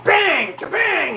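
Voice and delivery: loud, high-pitched vocal bangs; imitated banging. Homeowner mimics the racket by the staircase, loud high bangs. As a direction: blurt out crashing noises, jumping up in pitch. Imitating noise from the trim work.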